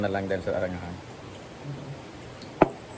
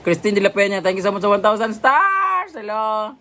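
excited shout-out; 8 semitones higher than usual. Real, not acted. Thanking a viewer for stars.

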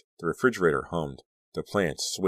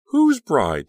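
much higher, loud alarmed cry; cried out; 12 semitones higher, 10 dB louder than usual. A narrator's reading. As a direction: cry it out in horror. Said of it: "Danielle cried".

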